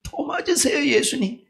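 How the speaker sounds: imitating his weeping prayer, pitched high; retold tearful plea. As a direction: cry out to Jesus as he remembers it. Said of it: "그래서 내가 울면서".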